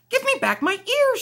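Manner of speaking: high, insistent little plea; high demand. Acted. Kitten to the bunny, insisting high and pleading. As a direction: demand your ears back in a high voice. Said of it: "she said".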